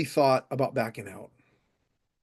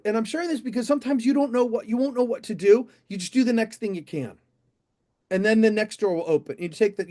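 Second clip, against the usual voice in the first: ordinary delivery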